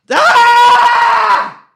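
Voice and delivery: long, high scream of frustration; angry scream. Shown as a demonstration. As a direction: scream out your annoyance at full volume. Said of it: "screamy and angry right now".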